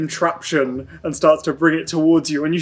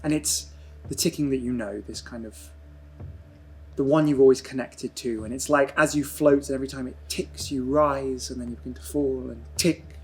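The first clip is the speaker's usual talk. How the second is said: dreamy scene narration; 9 dB quieter than usual. Acted. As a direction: let the words float like drifting in nothing. GM narrates Mason's drifting dream.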